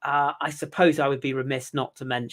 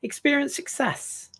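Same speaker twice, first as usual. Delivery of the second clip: mock tempting; 4 semitones higher than usual. Luring Carl to the French team.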